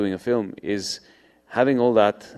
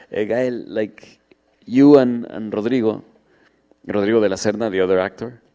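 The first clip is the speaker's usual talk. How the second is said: put-on Argentinian accent; 3 semitones higher, 3 dB louder than usual. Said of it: "he starts to say".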